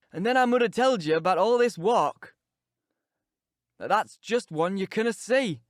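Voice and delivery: put-on a cantankerous old servant grumbling; grumbling Yorkshire servant.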